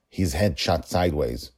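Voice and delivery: ordinary delivery, nothing marked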